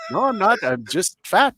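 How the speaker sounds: ordinary talk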